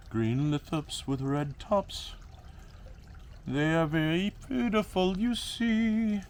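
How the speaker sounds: high, slow, drawn-out singsong; playful singsong presenting. Pretend. Vlogger showing off green lithops in slow, high singsong. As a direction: lilt it slowly, up high.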